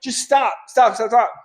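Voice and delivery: urgent stop. Acted. Warning recruits at the elevator, high urgent stop. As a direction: pitch it high and urgent, halt them.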